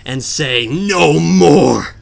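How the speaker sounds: loud, high, drawn-out defiant dare; dramatic challenge. Acted. Player challenging the boss to stand there, dramatic challenge. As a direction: rise to a big theatrical shout. Theatrical challenge to the game enemy.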